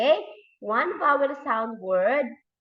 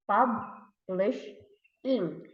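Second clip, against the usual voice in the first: "Publish in" as pub, lish, ing in separate beats; syllable by syllable; 3 dB quieter, much slower than usual. Shown as a demonstration. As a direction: pull "Publish in" apart into three pieces. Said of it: "If we're going to put it together".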